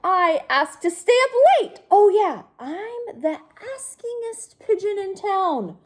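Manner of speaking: high, bragging pigeon; boastful pigeon. Acted. Pigeon brags about asking, high. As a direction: show off proudly.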